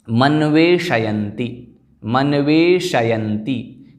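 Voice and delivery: slow, syllable by syllable: मन्वेषयन्ति with a full ष; careful pronunciation. Shown as a demonstration. Teacher models मन्वेषयन्ति slowly for students to repeat. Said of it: "अतः मन्वेषयन्ति".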